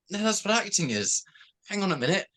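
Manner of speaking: mock protest. Actor to fans who mistake him for his roles, mock protest. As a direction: act out correcting a confused fan. Acts out correcting confused fans.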